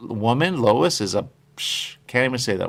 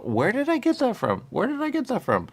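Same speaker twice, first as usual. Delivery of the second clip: much higher, puzzled mock wail; baffled disbelief; 13 semitones higher than usual. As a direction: play the fool who can't recall where he heard it. Mock confused self, "you go.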